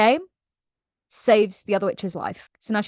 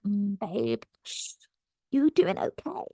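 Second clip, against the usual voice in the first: cooing concern; 3 dB quieter than usual. Retold or imitated. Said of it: "just like".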